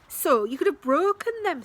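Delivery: high, loud alarmed reproach; alarmed protest. Acted. Max alarmed at Sasha, high loud reproach. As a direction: scold in alarm. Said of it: "said Max".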